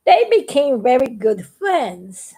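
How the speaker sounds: ordinary delivery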